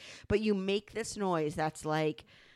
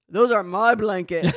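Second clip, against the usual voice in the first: grumpy husband; 10 dB louder than usual. Retold or imitated. Wife imitates husband in bed.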